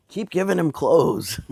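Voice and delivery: high, slow mock voice of the husband; imitating the husband. Retelling the husband urging his wife on, in a high mock voice. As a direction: egg her on, hammed up. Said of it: "Her husband was like".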